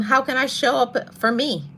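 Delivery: ordinary talk, nothing marked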